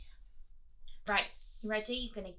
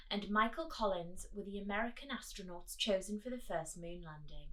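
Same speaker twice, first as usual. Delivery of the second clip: storybook reading; 3 semitones higher, 5 dB louder than usual. Acted. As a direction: announce it like a story opening. Reads history to children.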